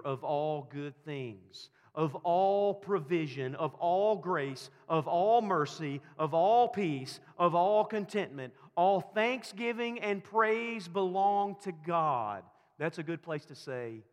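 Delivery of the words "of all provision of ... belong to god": slow, loud, higher preaching list; preaching at full force. Acted. Pastor driving home God's gifts, loud and slow. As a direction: build each "of all" to the congregation.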